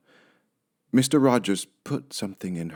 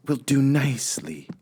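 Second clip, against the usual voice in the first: Amelia Bedelia voice; 6 semitones higher than usual. Acted.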